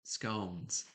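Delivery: scones with a long oh, rhyming with cones; scones as scoh-ns. Shown as a demonstration. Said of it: "'cause I always say".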